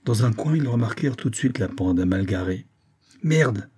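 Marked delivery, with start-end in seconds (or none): 3.2-3.7 roaring curse. Acted. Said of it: "rugit Pérez".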